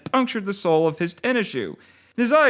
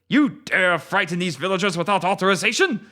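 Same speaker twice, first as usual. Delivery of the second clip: put-on gruff Japanese-accented ninja. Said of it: "in a gruff Japanese-sounding accent".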